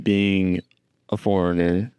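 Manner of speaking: ordinary delivery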